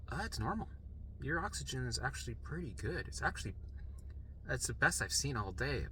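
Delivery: soft, understated reassurance; quietly matter-of-fact, imitating the nurse; mild nurse voice. Nurse telling worried patient his oxygen is fine, softly.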